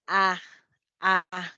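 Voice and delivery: "A" as a full, open Spanish ah; wide-open A. Shown as a demonstration. Teacher showing students a vowel, wide-open "A". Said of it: "con la boca así bien abierta".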